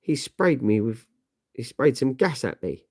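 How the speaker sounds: ordinary talk, nothing marked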